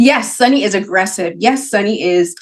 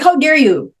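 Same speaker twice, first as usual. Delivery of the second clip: outraged parent; 6 semitones higher than usual. Retold or imitated. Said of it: "you're like".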